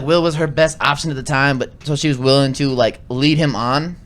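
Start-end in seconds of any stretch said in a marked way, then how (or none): none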